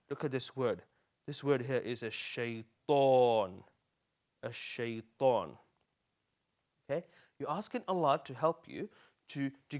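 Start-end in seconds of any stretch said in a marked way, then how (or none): 2.0-5.6 "a shaitan" slow and lower, final "aan" held; slow teaching pronunciation. Shown as a demonstration. Teacher pointing out this word, "a shaitan" slow and stretched. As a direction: draw "a shaitan" out syllable by syllable.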